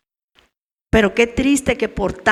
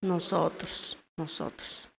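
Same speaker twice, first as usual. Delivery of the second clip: "Nosotros" said low and soft, then whispered; fading to a whisper; 5 semitones lower, 15 dB quieter, slower than usual. Shown as a demonstration. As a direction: let the second "nosotros" die into a whisper. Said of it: "¿quién?".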